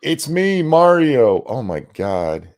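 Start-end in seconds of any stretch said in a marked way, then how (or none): 0.0-1.4 high, loud mock Mario catchphrase; high-pitched send-up of a weak Mario voice; mock accentless Mario. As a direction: proclaim it like Mario without the accent. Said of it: "tried to do the accent".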